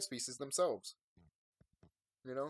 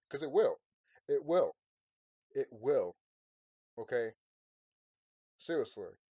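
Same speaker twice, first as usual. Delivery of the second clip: louder, building into real shouting at the viewers; angry rant; 7 dB louder than usual. Real, not acted. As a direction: let the anger boil over on the last lines. Ranting at comfortable viewers.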